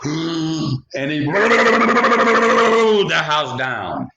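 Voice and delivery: very slow, high, loud stretched blow; wolf blowing with all his might; huge blow. Acted. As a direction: draw it out like a giant breath. Wolf blows house down.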